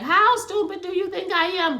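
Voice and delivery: high, indignant mock question; acted-out indignant squawk; imitated upset outburst. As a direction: throw it out high and affronted. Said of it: "she gets so upset".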